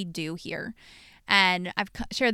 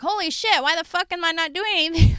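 high, alarmed mock client; a hammed-up high panic; panicked former clients; 8 semitones higher, 6 dB louder than usual. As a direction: play a client who suddenly flounders. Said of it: "they're like".

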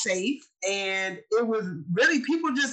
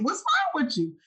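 higher, teasing "what's wrong with you"; imitating Aunt Michelle; 7 semitones higher than usual. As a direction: mimic the aunt poking fun. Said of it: "that's exactly how you would say it".

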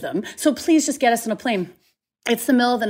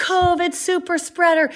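mock yell; 9 semitones higher, 4 dB louder, slower than usual. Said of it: "people are yelling".

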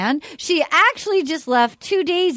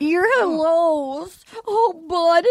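mock weeping; 3 semitones higher, much slower than usual. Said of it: "she's weeping".

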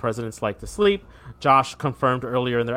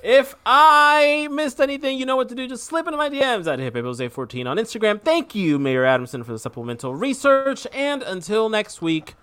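high, sing-out host outro; announcer sign-off; 10 semitones higher than usual. Acted. Host closes segment for listeners, showy high announcer sign-off. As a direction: project a showy, high-pitched closing plug.

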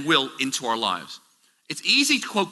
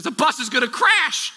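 mother screaming; 9 semitones higher than usual. Retold or imitated. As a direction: imitate a frantic mother forbidding it. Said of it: "She goes".

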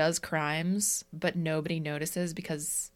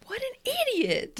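mock idiot voice. Mocking idiot remark.

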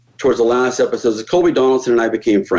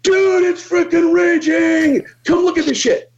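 much higher, slow stretched excitement; ecstatic crew call; slower than usual. Retold or imitated. Crew guy running out to show the perfect split, much higher excited. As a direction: drag it out like the guy can't believe the cut. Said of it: "He comes out, he goes".

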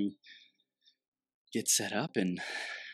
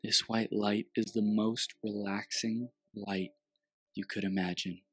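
guided meditation. Acted.

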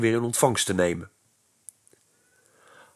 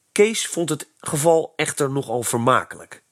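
ordinary delivery, nothing marked

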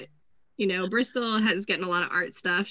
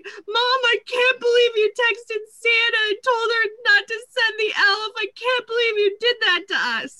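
put-on high, loud whiny child voice; upset daughter; 13 semitones higher, 5 dB louder than usual. Mother imitates daughter scolding her about Santa. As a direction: play the wronged kid, pitched up. Daughter's outraged quote retold.

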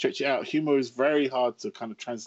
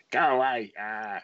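put-on grumpy old man. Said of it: "Victor Meldrew stage of life".

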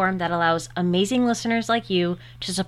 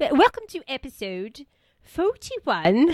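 slow, hammed-up British imitation; mock British accent; 5 semitones higher, slower than usual. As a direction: copy him in a put-on British accent. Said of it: "I think that's a totally different accent".

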